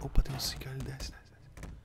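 urgent hushed whisper. Real, not acted. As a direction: whisper so the seeker won't hear. Hiding from seeker nearby.

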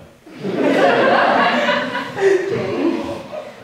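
long, high, loud giggle; nervous giggle before a confession; embarrassed high giggle; high giggling laugh. Real, not acted.

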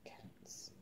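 hushed calling, barely voiced; soft whispered call. Real, not acted. Owner calling her cats in a whisper. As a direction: whisper the cats' names to call them.

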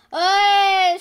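very loud, high shout; calling out. Real, not acted. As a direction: holler it out suddenly. Calling out during the game.

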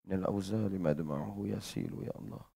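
soft, hushed murmur of the Arabic verse; hushed devotional murmur. Acted. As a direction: say it under the breath, reverently. Quiet murmured repeat of the verse.

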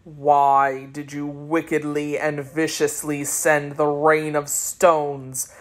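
low, slow, accusing sorcerer voice; deep sorcerer voice, slowly charging them with wickedness. Acted. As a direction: drag it out deep and cold, laying blame. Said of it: "he continued".

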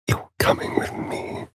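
low threatening whisper; menacing whisper. Acted. Vampire seizing someone, whispered menace. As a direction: hiss it like a threat.

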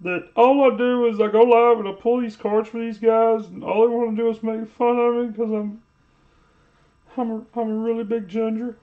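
high, whiny mock voice; high whiny imitation of a streamer complaining. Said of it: "He's like".

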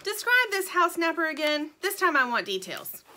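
no-nonsense Sugar. A narrator's reading. Sugar questioning the little bird, firm and demanding. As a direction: question the witness like a tough detective.

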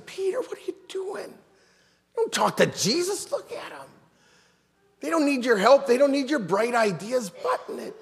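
John urgently hushing Peter in front of Jesus; John scolding Peter. Retold or imitated. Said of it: "And is John saying".

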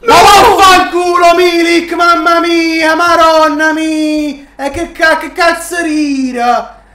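loud, high, slow furious swearing; furious shout. Real, not acted. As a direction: rage at the missed goal. Missed open goal, furious swearing.

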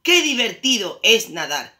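high, loud happy cry; thrilled little swimmer; delighted exclamation. A narrator's reading. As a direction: exclaim with storybook glee.

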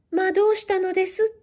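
a neighbour's high, startled call; alarmed neighbour crying out in surprise. Acted. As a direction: rush in asking what happened. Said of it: "と言って駆けつける".

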